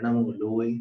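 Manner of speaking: "लोए" with the final e kept short; short final vowel. Shown as a demonstration. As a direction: say "लोए" without stretching the last e. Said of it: "लघु उच्चारित करना है".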